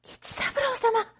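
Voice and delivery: high, slow, stretched cry of his name; a girl stunned to see her love; surprised young girl. Acted. Oshichi recognising Kichisaburo in disguise, high and slow.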